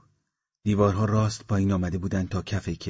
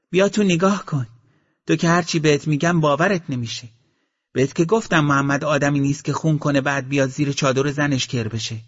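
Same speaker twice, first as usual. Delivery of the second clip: raised, higher, louder woman's voice; a wife scornfully daring the soldier to look; defiant wife; 7 semitones higher, 6 dB louder than usual. Acted. Shahru answers from inside hut.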